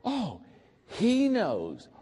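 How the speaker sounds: high, relieved employee voices; mock relieved gush as the team. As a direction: act out the staff's relief. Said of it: "It would be like".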